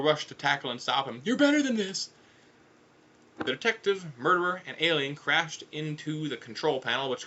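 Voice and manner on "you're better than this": high, urgent appeal; pleading shout. Acted. Foyle rushing to tackle Big Zam, pleading shout. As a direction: shout it high to bring a friend to their senses.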